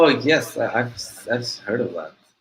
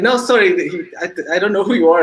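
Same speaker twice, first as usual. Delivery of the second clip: imitated brush-off, higher and quick; dismissive sister; 7 semitones higher, 4 dB louder, faster than usual. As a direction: hurry the caller off the line, pitched up. Sister brushes off the irked caller.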